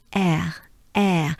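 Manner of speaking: "Air" with a French accent, open e and throaty r. Shown as a demonstration. Teacher giving learners the word, French accent. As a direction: say "Air" as a French speaker would. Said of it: "air with a French accent".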